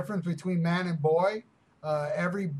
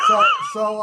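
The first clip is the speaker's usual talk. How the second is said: high squeal; 10 dB louder than usual. Acted. Sudden squeal in the bit.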